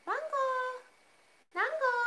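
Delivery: high calling out of "Mango!", sung up to the cat. Real, not acted. Owner calling her cat Mango from across the room, high call.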